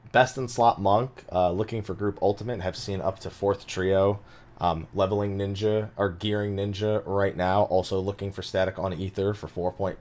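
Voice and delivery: soft, level recital of an advert; party finder listing. Pretend. Pitching for a raid group mid-show, as a PF title. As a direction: reel it off quietly like a posted listing. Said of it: "that's just a P F title right there".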